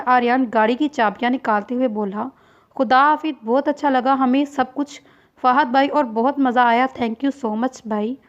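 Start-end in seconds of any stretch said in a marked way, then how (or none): none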